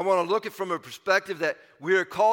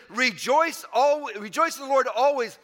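higher-pitched, emphatic scripture quote; more emphasis; 6 semitones higher, 4 dB louder than usual. Retold or imitated. Preacher quoting Paul's doubled-down command, with more emphasis. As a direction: press home REJOICE and ALWAYS, higher. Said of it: "he says it with more emphasis".

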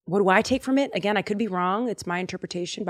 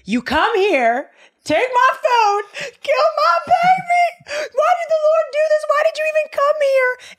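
high wailing cry, loud and breaking; crying shout; 7 dB louder than usual. Acted. Grieving widow accuses Elijah, crying and shouting. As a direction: scream it through sobs at the prophet. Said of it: "the widow's like".

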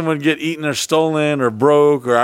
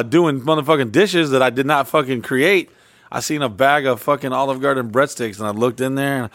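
ordinary delivery

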